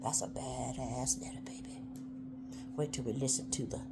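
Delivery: soft unvoiced whisper. Real, not acted. Whispering praise for the letter. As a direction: lean in and whisper it.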